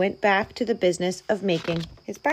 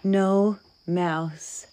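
usual speaking voice

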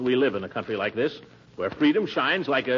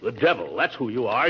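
ordinary delivery

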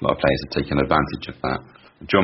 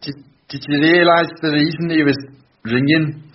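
higher try at a Liverpool Scouse accent; Scouse accent attempt; 10 semitones higher, 4 dB louder than usual. Shown as a demonstration. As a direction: put on a Scouse accent for the question. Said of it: "have a stab at these three sentences".

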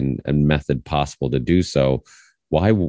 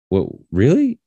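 higher, louder questioning lift; puzzled parent reaction; surprised questioning; 8 semitones higher, 3 dB louder than usual. Retold or imitated. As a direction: act out mild disbelief, voice rising.

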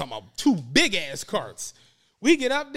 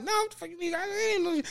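much higher, stunned denial; incredulous protest; 11 semitones higher, 4 dB quieter than usual. Retold or imitated. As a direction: protest in disbelief, voice jumping up. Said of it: "I'm like".

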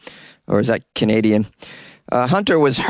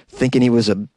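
normal talking voice

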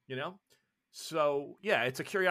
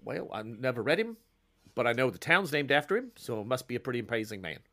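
laid-back Australian river guide; Australian accent. Acted. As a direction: tell a local tale in an Australian accent. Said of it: "whose Australian accent".

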